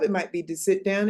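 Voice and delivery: ordinary delivery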